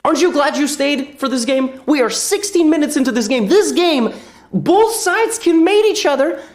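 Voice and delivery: high-pitched, incredulous excitement; thrilled commentator, pitch way up; excited commentary. Real, not acted. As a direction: hype up the crazy game, voice riding high. Excited reaction to a wild game.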